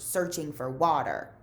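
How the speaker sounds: ordinary delivery, nothing marked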